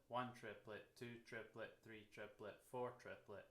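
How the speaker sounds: "One triplet, two triplet" chanted softly in even time; soft, steady rhythmic count, a number then "triplet". Shown as a demonstration. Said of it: "each one's a triplet".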